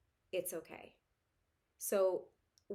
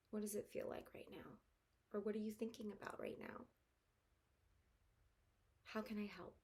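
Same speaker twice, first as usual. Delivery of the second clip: put-on softer, tender questions to a child; gentle listening; 2 semitones higher, 9 dB quieter than usual. Shown as a demonstration. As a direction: kneel and ask softly. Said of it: "I might say something like".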